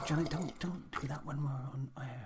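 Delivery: urgent on-air whisper. Acted. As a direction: hiss it so the mic misses it. Hushing co-host on air.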